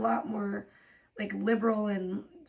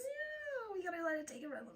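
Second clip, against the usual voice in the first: mocking quote; 5 semitones higher, 16 dB quieter than usual. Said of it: "It's just".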